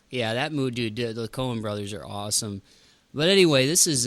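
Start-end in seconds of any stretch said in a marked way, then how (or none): none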